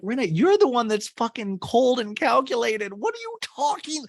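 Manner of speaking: high-pitched, exasperated shouting; indignant outburst. Retold or imitated. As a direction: berate the castmate in disbelief. Host acts out berating Rinna.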